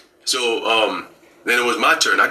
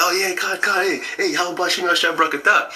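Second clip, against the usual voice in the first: 1 joyful greeting; 8 semitones higher than usual. Retold or imitated.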